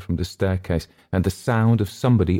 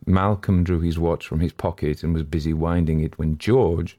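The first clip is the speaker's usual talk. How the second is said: ordinary delivery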